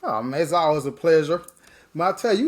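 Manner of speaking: normal talking voice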